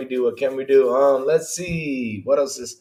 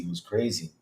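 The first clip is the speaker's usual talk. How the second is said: dropped much softer, an afterthought to himself; quiet echo; 6 semitones lower, 8 dB quieter than usual. Real, not acted. Streamer echoing to himself that the week was crazy, softly. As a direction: let it fall away under the breath.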